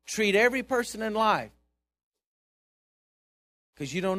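loud, high, emphatic sermon delivery; forceful preaching. Real, not acted.

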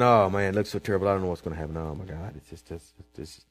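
a hint of anxious muttering; worried mumble. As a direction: mimic a nervous worrier. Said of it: "You walk around saying".

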